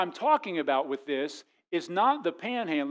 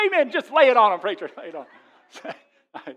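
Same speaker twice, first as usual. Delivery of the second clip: loud, higher cheer, then a slow laughing drawl; cheering wives; 4 semitones higher, 7 dB louder than usual. Retold or imitated. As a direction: egg the preacher on, amused. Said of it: "All the wives are like".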